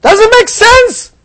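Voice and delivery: anxious voice of the brain. Retold or imitated. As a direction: let the brain's protest rise and rise into near-falsetto panic. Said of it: "And his brain says".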